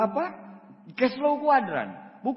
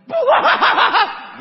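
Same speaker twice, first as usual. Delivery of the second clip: booming laugh; big loud guffaw; loud burst of laughter; 13 semitones higher, 10 dB louder than usual. Real, not acted. Big laugh mid story.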